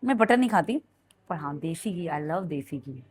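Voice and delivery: ordinary talk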